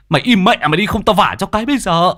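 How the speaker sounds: loud, high angry threat; panicked yelling. A narrator's reading. Father threatening to slap his son, panicked yelling. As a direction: snap at him to shut up, voice shooting up. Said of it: "tiếng quát thất thanh của Nôm".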